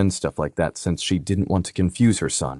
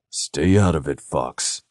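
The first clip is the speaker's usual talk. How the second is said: growled; 2 semitones lower, slower than usual. Acted. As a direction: growl it at your brother. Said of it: "Dare growled".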